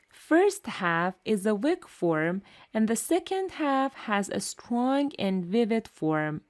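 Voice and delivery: ordinary talk, nothing marked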